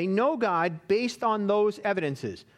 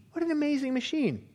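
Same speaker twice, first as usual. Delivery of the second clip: awed, higher repeat of "What an amazing machine"; marveling, higher; 6 semitones higher than usual. Retold or imitated. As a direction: lift the pitch in wonder on the second "What an amazing machine". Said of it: "marveled the famous agnostic".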